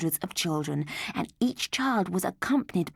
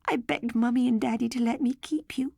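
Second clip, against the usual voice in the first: tearful; 6 semitones higher, 3 dB louder than usual. Acted. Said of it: "sobbed Mandy".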